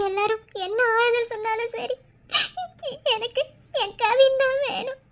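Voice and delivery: tearful, sob-broken lament; crying. Acted. New mother aches for her missing lover, crying. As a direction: weep while insisting on having him back. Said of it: "இதுக்கு மேல அழுகாத".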